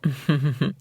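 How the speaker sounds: short, awkward little laugh; brief uneasy titter; nervous chuckle. Acted. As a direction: chuckle to cover an awkward moment. Awkward laugh after disconnected number.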